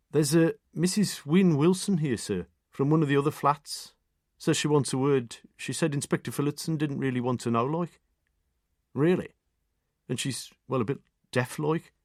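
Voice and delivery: a hint of a dutiful local PC; police constable. A narrator's reading. Constable reports to the inspector.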